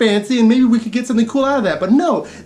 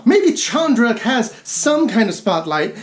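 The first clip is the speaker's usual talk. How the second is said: usual speaking voice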